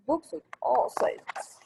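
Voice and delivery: ordinary talk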